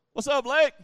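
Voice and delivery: mock yell across to someone; calling out. Shown as a demonstration. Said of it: "If I yell".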